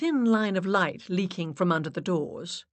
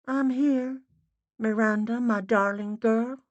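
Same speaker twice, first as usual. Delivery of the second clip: faint, muffled Boston mother; muffled Boston spirit voice; Boston accent; 6 semitones higher, 4 dB louder than usual. A narrator's reading. Dead mother greets Miranda in a faint Boston accent. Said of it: "woman speaking with a Boston accent, faint and muffled".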